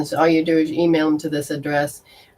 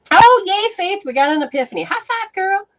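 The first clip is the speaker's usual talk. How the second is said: much higher, squealing yay and high five; excited cheer; 13 semitones higher than usual. Real, not acted. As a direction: cheer her on like a friend. Celebrates a client's epiphany.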